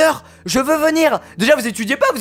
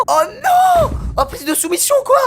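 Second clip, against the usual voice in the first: panicked squeal; 14 semitones higher than usual. Real, not acted. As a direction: shriek at getting pinned. Panic at being caught. (cri aigu de panique)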